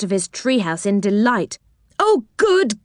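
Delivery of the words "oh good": high, delighted cry; cried out gladly. A narrator's reading. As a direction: greet them with joy, pitched up. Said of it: "he cried".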